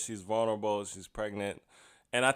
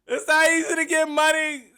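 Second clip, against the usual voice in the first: much higher, loud incredulous laughing yell; louder, higher shout of amused shock; 8 dB louder than usual. Retold or imitated. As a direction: shriek it through laughter in disbelief. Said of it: "So I'm like".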